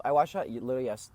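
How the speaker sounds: ordinary talk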